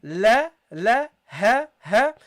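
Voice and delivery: loud, separate "la, la, ha, ha"; demonstrated syllables. Shown as a demonstration. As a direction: sound out "la" and "ha" one by one. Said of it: "and then you got here".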